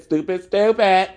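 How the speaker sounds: drawn-out taunting stupid, stupid. Acted. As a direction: lean into each stupid, building it up. Repeating insult with rising force.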